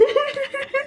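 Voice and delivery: a sudden high burst of laughter. Acted. Lanza laughing at Wing's robot cat, a high burst of laughter. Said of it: "Lanja हंसते हुए कहता है".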